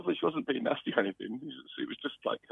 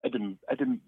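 ordinary delivery

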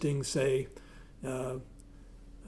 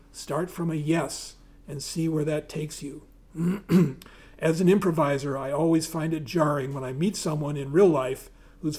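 louder, lively reading from a book; reading aloud; 5 dB louder than usual. Acted. Reading Tina Fey's improv rules to the audience, lively read-aloud voice. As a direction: read it out to the room with energy.

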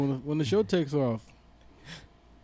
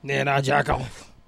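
imitating T I. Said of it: "like T I said".

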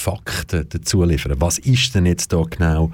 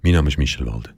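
extremely deep bass voice; 3 semitones lower, faster than usual. Acted. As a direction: drop the voice deep for a mock intro. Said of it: "mit tiefer Stimme".

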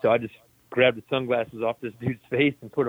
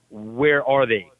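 drawn-out, louder demand, acted out; slow insistent demand; 5 dB louder, much slower than usual. Patient asking staff for his feet, slow insistent retold demand. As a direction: spell it out slowly, word by word.

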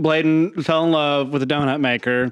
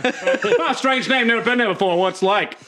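mock naive cop; 5 semitones higher than usual. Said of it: "he's like".

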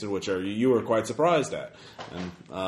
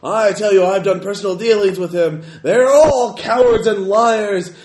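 booming arrogant alien overlord; 11 semitones higher, 11 dB louder than usual. Acted. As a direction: denounce the liar at full roar. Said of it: "that same booming voice".

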